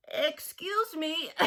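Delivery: slow, small cricket voice; lightly voiced little cricket, slow and polite. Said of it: "coughed a cricket".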